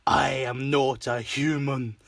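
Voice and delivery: slow, drawn-out cartoon declaration; Warning Guy. Retelling the gorilla fight, imitating the fisherman's slow declaration. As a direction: imitate a solemn announcement, word by slow word. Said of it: "Warning Guy".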